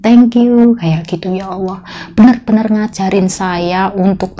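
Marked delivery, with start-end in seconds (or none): 0.0-0.8 grateful thank you. Retold or imitated. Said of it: "orang tersebut bilang".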